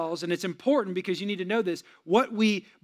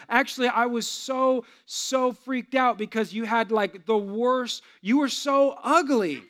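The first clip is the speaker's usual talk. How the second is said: slow, drawn-out retold mom; hammed-up dismay, rising higher at the end; comic confession; 4 semitones higher, slower than usual. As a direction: stretch each admission out for comic horror. Mom's blunt confession, acted for laughs.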